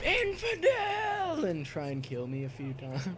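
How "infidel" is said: much higher, slow hammed-up cry; mock yell. Gamer imitating an enemy yelling at him, much higher. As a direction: cry the word out high and stretched. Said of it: "they'll yell out".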